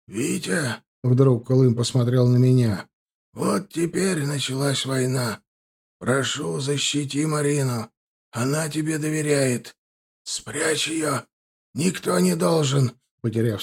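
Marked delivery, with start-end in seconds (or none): 0.1-0.8 soft, high, faint address; wounded man's feeble appeal; weak, strained call. A narrator's reading. Dying Kulym calls the narrator. 3.3-12.9 failing voice, breaking off unfinished; weak, dying voice. A narrator's reading. Dying Kulym's last plea, passes out.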